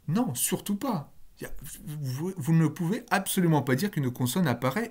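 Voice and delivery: ordinary talk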